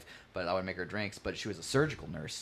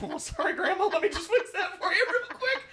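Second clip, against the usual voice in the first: very high, loud mock-flustered voice; imitating a panicked apology to grandma; 6 dB louder than usual. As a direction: squeak out a hurried apology through laughter. Acts out hasty apology to grandma.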